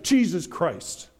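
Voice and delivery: ordinary talk, nothing marked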